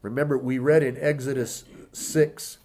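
usual speaking voice

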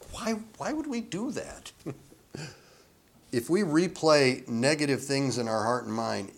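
usual speaking voice

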